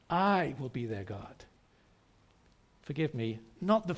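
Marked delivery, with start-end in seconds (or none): 0.1-1.4 "I" held long and firm, the rest tapering off; stress on I. Shown as a demonstration. Preacher repeating God's promise to the congregation, stress on "I". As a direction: put the weight on "I". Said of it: "I just put the emphasis on".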